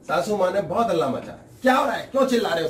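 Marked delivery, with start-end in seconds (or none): 1.6-2.7 quick, raised, scolding mother-in-law; mock scolding mother-in-law. Mother-in-law scolding her daughter-in-law over the noise, fast and raised. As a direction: rattle it off like an annoyed mother-in-law.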